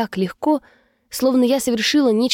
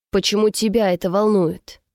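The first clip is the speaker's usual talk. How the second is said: ordinary delivery, nothing marked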